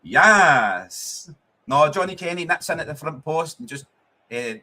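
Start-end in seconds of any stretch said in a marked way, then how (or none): 0.1-1.3 loud rising then falling yes; triumphant yell. Real, not acted. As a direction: shout it out as the goal goes in. Celebrating the Celtic goal.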